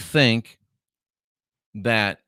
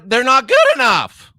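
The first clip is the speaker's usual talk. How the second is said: high, loud exclamation; emphatic punchline; 11 semitones higher, 5 dB louder than usual. Real, not acted. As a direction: burst out with the answer, pitched way up. Blurting his punchline answer.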